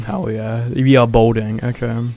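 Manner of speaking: usual speaking voice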